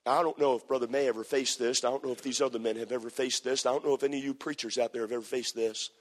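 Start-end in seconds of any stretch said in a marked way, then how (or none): none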